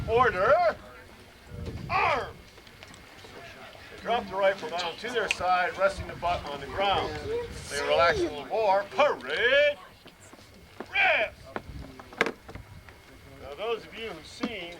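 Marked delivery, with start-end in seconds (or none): 0.1-2.4 barked parade-ground order, pitch leaping high on the last word; drawn-out command cut off sharp on "arm"; drill command. Shown as a demonstration. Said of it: "I'll give the command". 8.9-11.3 loud barked order, the second word leaping high; drill command. Shown as a demonstration. Instructor ordering troops to rest, a loud barked command.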